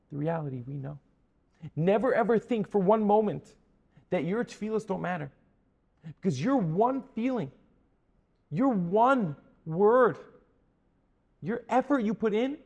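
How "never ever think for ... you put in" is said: loud, higher-pitched, rhythmic preaching; impassioned preaching. Real, not acted. Preacher to congregation on prayer's power, impassioned preaching. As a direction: build phrase by phrase, stretching the key words.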